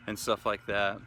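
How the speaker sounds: ordinary talk, nothing marked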